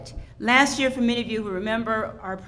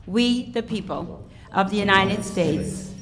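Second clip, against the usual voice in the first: group recitation. Real, not acted. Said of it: "if you will please join us".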